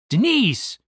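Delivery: high-pitched call of a name; mild cry of alarm. Acted. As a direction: call out in concern, pitch leaping then falling. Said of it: "cried Randy".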